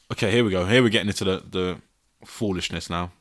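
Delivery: ordinary talk, nothing marked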